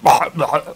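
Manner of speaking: retching dog noise. Retold or imitated. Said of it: "Dog comes over and goes".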